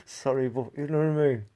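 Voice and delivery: ordinary talk, nothing marked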